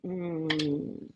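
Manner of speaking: low, soft reluctant "mm, no"; reluctant group. Retold or imitated. Host imitating her shy group declining to come on, low reluctant refusal. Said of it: "my group is like".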